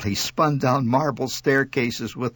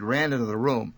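ordinary delivery

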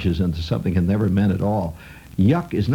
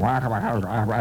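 imitating background news drone. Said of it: "reduced to the status of background music".

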